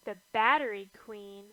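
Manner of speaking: ordinary delivery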